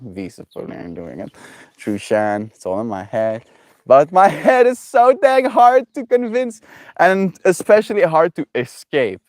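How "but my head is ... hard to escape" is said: laughing. Real, not acted. Laughing about own stubborn head.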